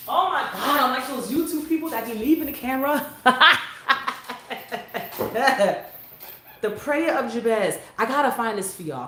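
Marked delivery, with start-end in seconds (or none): 3.1-5.9 long burst of real laughter; laughing at herself. Real, not acted. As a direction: crack up at your own fumbling.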